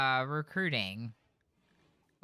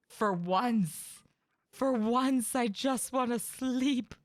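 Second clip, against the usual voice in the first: a bit higher, slow whiny sighing; weary colonist; 5 semitones higher, slower than usual. Retold or imitated. Streamer voicing a tired colonist wanting sleep, slow whiny plea. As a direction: moan for a night's sleep. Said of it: "I imagine they're just like".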